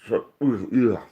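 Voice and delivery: groaning through a yawn; a yawn mixed with a hiccup. Real, not acted. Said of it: "I was yawning and".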